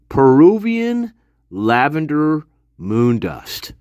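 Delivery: Peruvian lavender moon dust drawn out slowly; over-enunciated, slow; slow enunciation. Shown as a demonstration. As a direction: spell out every syllable. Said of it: "It's called enunciation".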